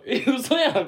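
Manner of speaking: high, loud startled yelp; shocked. Retold or imitated. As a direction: blurt it out in disbelief. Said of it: "って言うて".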